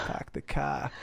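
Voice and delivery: put-on slow, drawn-out Boston accent. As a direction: say it like a Boston local. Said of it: "That's always Boston to me".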